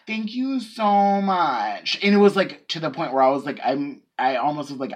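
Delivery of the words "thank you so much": high, drawn-out imitation of effusive thanks; Gia Gunn-like gushing. Cashier mimicking customer's repeated thanks, over-effusive and drawn out. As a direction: stretch every word slowly, pitched up and syrupy. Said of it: "like, Gia Gunn kind of".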